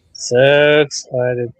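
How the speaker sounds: very slow, drawn-out deadpan; sarcastic fake excitement. Host sarcastic about wrestling in WWE, dragged-out deadpan. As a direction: stretch each word with mock enthusiasm. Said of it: "hear the excitement in my voice".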